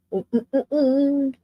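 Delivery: eating while making noise; humming through a mouthful; chewing noisily. Real, not acted. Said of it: "I'm sorry I'm eating".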